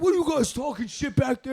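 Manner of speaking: high, loud retold challenge; calling back. Fry asks what the back seat is saying, high and loud.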